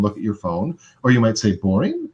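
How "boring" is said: BORING as a high, rising question; curious tone. Shown as a demonstration. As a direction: ask "Boring?" with open curiosity. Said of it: "in sort of a curious tone".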